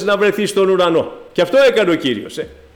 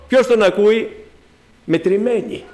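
ordinary talk, nothing marked

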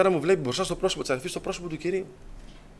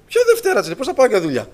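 very high, loud incredulous outburst; indignant mother; 9 semitones higher, 6 dB louder than usual. Retold or imitated. Mother refuses to wait for Monday, indignant. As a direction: squawk it back in disbelief.

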